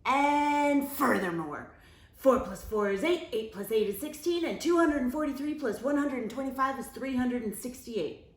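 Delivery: loud, then rattling fast; loud fast know-it-all; showing off. Acted. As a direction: reel off the sums to show off.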